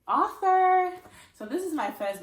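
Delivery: loud, high, drawn-out reveal; excited announcement. Real, not acted. Announcing to viewers a first book, excited. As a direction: sing it out proudly, high and loud. Proudly announces becoming an author.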